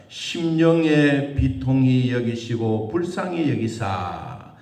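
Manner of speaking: slow, weighty reading with the stress on 심령의; slow scripture reading. Shown as a demonstration. Pastor reading the verse to the congregation, slow and solemn. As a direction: read it out slowly, each word 심령의 비통이 laid down with weight. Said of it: "삼십삼 절 끝부분에 보니까".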